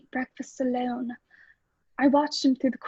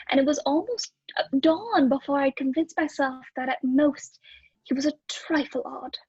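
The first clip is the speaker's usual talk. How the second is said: ordinary talk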